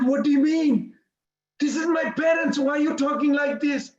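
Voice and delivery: high-pitched, pleading protest; retold upset protest. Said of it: "Krishna started crying".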